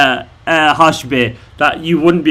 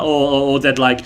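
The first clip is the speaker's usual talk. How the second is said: ordinary delivery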